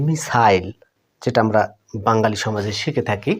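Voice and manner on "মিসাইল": মিসাইল said the British way, MIS-ail with a long ai; মিসাইল, British style; British pronunciation. Shown as a demonstration. Teacher modelling British মিসাইল with a clear ai. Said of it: "British ইংলিশে বন্ধুরা এটার উচ্চারণ হয়".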